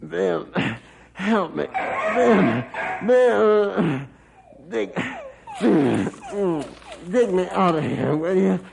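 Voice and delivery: trapped man calling his dog in panic; desperate calls for help. Acted. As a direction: strain and beg the dog to dig. Pinned under tractor, calling dog.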